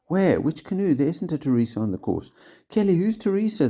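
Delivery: high loud urgent calling; urgent shout. A narrator's reading. Teachers shouting urgently to Kelly, high and loud. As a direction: shout out in alarm. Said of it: "they shouted urgently".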